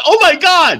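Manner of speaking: high, loud game-show scream; screaming contestant. Retold or imitated. Contestant reaching the podium sees Drew, imitated high scream. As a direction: shriek it like an overexcited contestant. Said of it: "She comes screaming".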